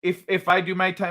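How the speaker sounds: ordinary talk, nothing marked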